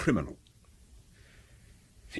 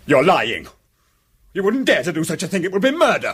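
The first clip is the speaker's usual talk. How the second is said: loud, rising outraged denial; angry denial; 7 semitones higher, 3 dB louder than usual. Acted. Cornered poisoner shouts at Lord Peter in fury. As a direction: hurl the accusation back at him.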